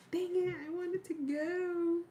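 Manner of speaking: high, whiny disappointed quote; frustrated whine. Retold or imitated. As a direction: act out the letdown. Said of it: "And then I'm like".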